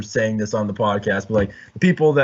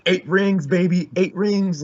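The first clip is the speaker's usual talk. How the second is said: in-your-face bragging; 7 semitones higher, slower than usual. Pretend. Podcaster imagining himself as a winning fan, in-your-face bragging. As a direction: pretend boastful fan, pitched up. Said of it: "up in people's faces about it".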